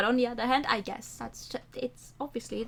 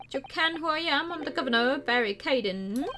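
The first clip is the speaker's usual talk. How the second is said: lightly Scottish prison governor; a hint of a gruff Scottish governor; Scottish accent; 3 semitones higher than usual. Governor greets the visiting lawyer in a light Scottish accent.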